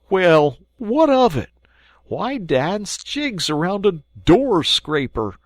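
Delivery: drawn-out, unimpressed; slow grumpy Mole. Acted. Mole scoffing at Rat's find, slow and sceptical. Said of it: "he said at last slowly".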